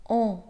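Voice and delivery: on as a clear nasal vowel; sound demo. Shown as a demonstration. Teacher shows the learner the nasal on sound. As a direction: show the nasal sound on alone. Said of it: "pour former le son".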